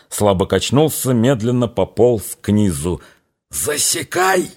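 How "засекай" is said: high, slow, breathy urgent call; breathed out. A narrator's reading. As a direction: breathe the word out sharply. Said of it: "выдохнул".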